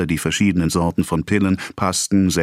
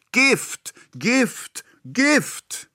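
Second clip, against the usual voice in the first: Gift, Gift, Gift each much higher and spooky; dramatic repetition; much slower than usual. A narrator's reading. Pharmacist dramatizing the poison label to the boy. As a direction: intone the warning word.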